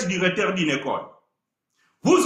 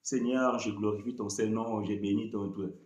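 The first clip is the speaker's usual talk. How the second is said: soft, low murmured prayer; understated quiet prayer; quiet ordinary prayer; 11 semitones lower, 10 dB quieter than usual. Retold or imitated. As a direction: pray calmly under the breath. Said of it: "vous priez normal".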